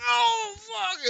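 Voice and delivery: high, loud shout of pain. Acted. Kenku struck in the shoulder, high pained shout. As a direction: cry out as the axe hits. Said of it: "he looks over and goes".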